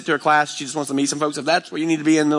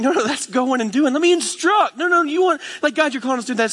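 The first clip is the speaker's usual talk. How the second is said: high, insistent preacher outburst; impassioned protest; 13 semitones higher than usual. Acted. Preacher acting out pushback on God's calling, impassioned. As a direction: pitch it way up, urgent and insistent.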